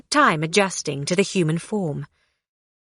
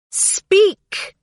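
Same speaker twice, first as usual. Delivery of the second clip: commanding; 12 semitones higher than usual. A narrator's reading. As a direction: order him to speak. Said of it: "she commanded".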